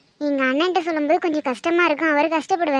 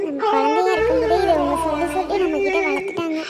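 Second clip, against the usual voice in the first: speaking through tears, louder; tearful complaint; 3 semitones higher, 3 dB louder than usual. Real, not acted. Girl heartbroken over Ragu, speaking through tears. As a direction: hold back a sob while you explain.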